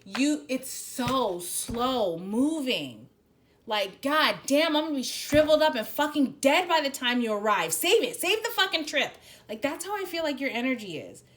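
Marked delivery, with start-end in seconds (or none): 0.0-9.2 irritated rant. Retold or imitated. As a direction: vent the impatience, building to a yell. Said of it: "I get irritated because I'm like".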